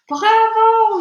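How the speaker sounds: very high, very loud cheer; yelled bravo. A narrator's reading. Witch audience yells its delight at the spell. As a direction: roar it like a thrilled crowd. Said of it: "yelled the audience".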